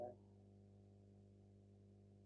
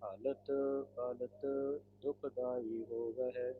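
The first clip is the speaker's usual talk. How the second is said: slow, measured scripture reading; gurbani recitation; 6 dB louder, slower than usual. Shown as a demonstration. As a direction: read each verse with reverent pauses. Reads Gurbani path aloud. (Gurbani ਪਾਠ)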